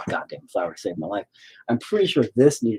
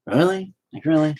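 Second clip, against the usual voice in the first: exasperated; 9 semitones higher, 3 dB louder than usual. Retold or imitated. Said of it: "you just gotta be like".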